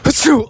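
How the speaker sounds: loud staged sneeze; fake sneeze. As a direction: sneeze right at him. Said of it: "Round two of this bit".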